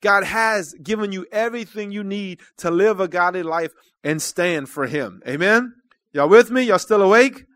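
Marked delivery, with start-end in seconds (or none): none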